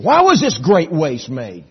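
high, loud, indignant gripe; griping Judas. Acted. Judas griping to the disciples about the waste, high and loud. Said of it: "Judas started griping".

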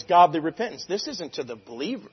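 ordinary delivery

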